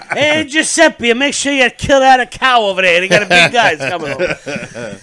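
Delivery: put-on old Italian lady. Italian lady ordering Giuseppe to prepare for big guys, hammed up. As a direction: call out like a hammed-up Italian grandma. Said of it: "little old Italian lady's like".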